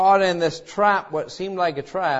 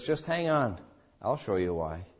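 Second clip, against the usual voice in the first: reassuring God; 7 semitones lower, 9 dB quieter than usual. Retold or imitated. God voiced reassuring the believer.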